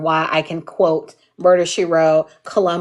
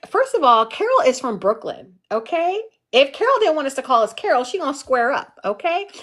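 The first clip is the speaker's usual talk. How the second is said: higher, sassy with rising okays; sassy defense; 6 semitones higher than usual. Retold or imitated. Defending grandmother to strangers, higher sassy tone. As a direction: put them in their place. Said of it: "But I'm like".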